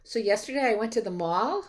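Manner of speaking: "mall" swept up high like a question; Valley Girl uptalk. Shown as a demonstration. Lecturer showing students Valley Girl speak, questioning uptalk. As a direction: end the statement on a questioning rise at "mall". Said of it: "it was called Valley Girl Speak".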